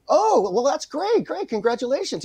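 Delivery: retold congratulations. Congratulating friend on his new show.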